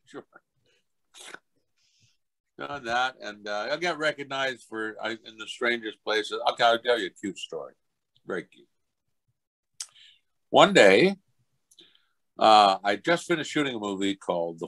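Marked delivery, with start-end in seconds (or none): none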